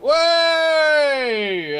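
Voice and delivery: high, long-held "way!" cheer; crowd cheering. Acted. Everyone cheers as the barrel bursts open, long whoop. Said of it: "everyone's like".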